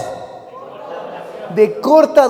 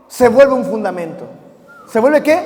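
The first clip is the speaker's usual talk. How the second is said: normal talking voice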